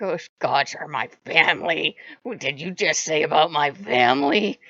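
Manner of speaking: low, loud territorial growl; mock snarl. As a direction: snarl it like someone defending their family. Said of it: "stand up for my territory and snarl".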